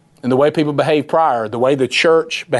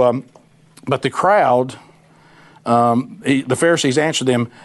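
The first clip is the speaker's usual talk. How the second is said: normal talking voice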